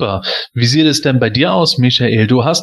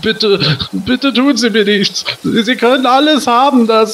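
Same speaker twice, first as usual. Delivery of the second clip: higher, frightened begging; pleading in fear; 8 semitones higher than usual. Acted. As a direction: beg for mercy, voice climbing. Victim begs the robber.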